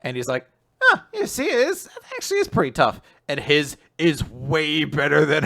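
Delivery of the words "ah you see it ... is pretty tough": much higher, fast and falsely humble; mock modesty. Voicing Yuiga pretending stitching is hard, high mock-modest. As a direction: feign struggling with a sly squeak.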